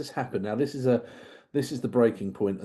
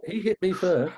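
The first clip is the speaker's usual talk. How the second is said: put-on childish excuse; 7 semitones higher than usual. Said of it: "he puts it behind the back".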